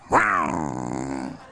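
deep mock growl of desire; low teasing rawr; playful growl. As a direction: growl like a hungry lioness. Girls ogling new convert.

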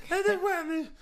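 put-on high whining girl; whiny girl voice. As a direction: whine it like a girl. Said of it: "She's like".

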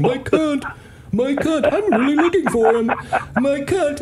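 high, mocking repeated call; hammed-up paging across a room; bartender doing voices. Jerk bartender paging a prank name, high calling voice. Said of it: "and doing in voices".

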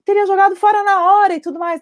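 appalled mother reacting to crack; shocked parent. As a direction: gasp it out like an alarmed parent. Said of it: "os pais arregalam os olhos e falam".

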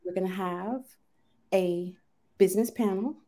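low, measured business tone; serious voice. Pretend. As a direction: put on a playful mock-serious host voice. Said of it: "this is my serious voice".

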